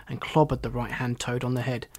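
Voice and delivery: ordinary delivery, nothing marked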